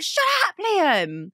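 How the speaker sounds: sudden high, loud shout; yelling at Liam. Retold or imitated. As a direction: bark it at the nagging passenger. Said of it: "I'm like".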